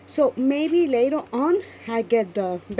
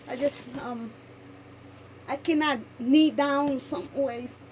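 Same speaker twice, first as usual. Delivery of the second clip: ordinary talk, nothing marked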